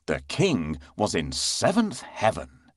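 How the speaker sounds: ordinary delivery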